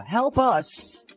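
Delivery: normal talking voice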